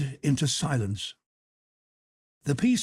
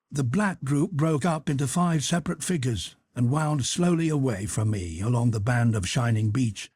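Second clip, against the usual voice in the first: plain reading voice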